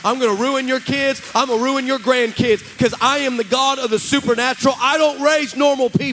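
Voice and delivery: loud, driving, repetitive build to a shout; relentless preacher's crescendo; full-force preaching. Retold or imitated. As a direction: voice God's declaration with mounting force. Preaches God's answer at full force.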